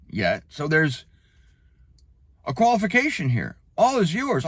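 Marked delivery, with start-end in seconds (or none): 3.8-4.4 "All is yours." tossed off higher, skipping past yet; rushed reading. Shown as a demonstration. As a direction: hurry through "All is yours." as if done. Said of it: "Slow down".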